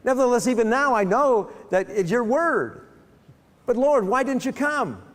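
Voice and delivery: frustrated pleading, voice pushed high; a grieving sister blaming Jesus for coming late. Retold or imitated. Martha reproaching Jesus, frustrated and high. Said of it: "she's just frustrated".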